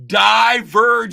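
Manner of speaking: "Divergence" slow, loud and high; shouted syllables. Shown as a demonstration. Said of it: "type it out with the correct spelling".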